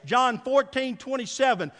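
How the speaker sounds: usual speaking voice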